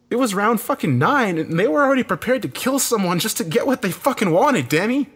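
loud, high, furious rant; angry scream. A narrator's reading. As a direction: scream the accusation at him. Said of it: "he screamed angrily".

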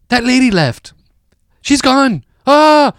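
high-pitched mock kid cheer; gleeful kids. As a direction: imitate kids rejoicing that the grown-up left. Kids behind him celebrating her exit.

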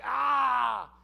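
loud, drawn-out "aah" of dread; exasperated yell. Acted. Preacher dreading a conversation he doesn't want, exasperated cry. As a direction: let out a long exasperated cry.

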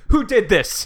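loud, high outraged yell; screaming. Retold or imitated. Frantic woman screaming into the street, outraged yell. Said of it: "she's, like, screaming into the streets".

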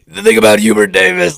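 imitating a voice that barely gets out; strained raspy voice. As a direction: push the words through a failing throat. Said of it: "kind of like I'm doing right now".

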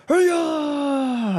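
high, drawn-out cheer; crowd cheer. Acted. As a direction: cheer like a whole fight crowd. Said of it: "everyone's like".

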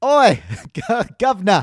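high hammy Cockney, laughing; Cockney accent. Retold or imitated. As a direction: slide into a mock Cockney. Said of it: "I'm like turning into".

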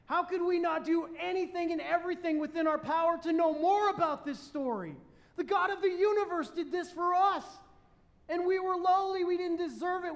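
fiery preacher's climax; full-force preaching. Acted. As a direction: drive it home to the congregation.

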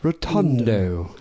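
slow posh English "Rotundo" with long vowels; posh Hugh Grant accent. Pretend. Imitating Hugh Grant for friends, slow and posh. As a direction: drawl "Rotundo" in a mild Hugh Grant style. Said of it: "like, Hugh Grant saying it".

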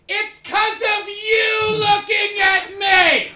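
long, high, stretched-out yell; hammed-up mock yell, very high and loud; imitating Tim's shout. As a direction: bellow it slowly at the starers. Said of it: "He does things like".

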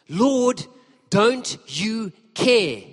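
high, loud, drawn-out complaint; exasperated outburst. Retold or imitated. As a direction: snap it at Jesus, stretching care. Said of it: "she snaps".

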